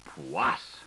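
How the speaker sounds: a sudden low exclaimed oath; low exclamation. A narrator's reading. The scaly man exclaiming at a mention, in a low blurt. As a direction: spit the single word out, dropped low.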